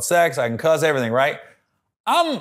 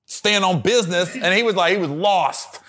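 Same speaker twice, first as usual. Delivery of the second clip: usual speaking voice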